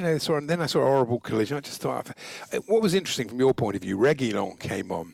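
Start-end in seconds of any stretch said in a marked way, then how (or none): none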